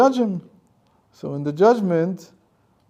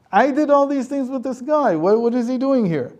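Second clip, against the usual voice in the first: much higher, louder indignant protest; indignant complaint; 10 semitones higher, 5 dB louder than usual. Acted. Satan complaining to the heavenly tribunal, high and loud. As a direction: demand an answer from the court.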